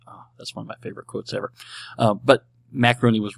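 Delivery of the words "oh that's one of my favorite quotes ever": fast, soft whispered aside. Real, not acted. Laughing aside.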